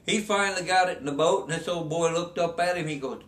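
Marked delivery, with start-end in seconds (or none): none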